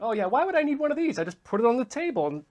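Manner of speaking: high, fast, dismissive mock voice; mocking past self. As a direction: scoff at the idea, high and quick.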